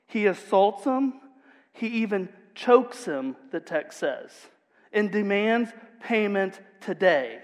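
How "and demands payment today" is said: usual speaking voice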